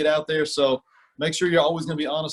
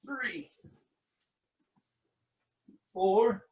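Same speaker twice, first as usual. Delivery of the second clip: counting between burpees, winded; panting rep count; breathless counting; 4 semitones higher, 6 dB quieter, much slower than usual. Real, not acted. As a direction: gasp out each number mid-exercise. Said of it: "I'm gonna be out of breath".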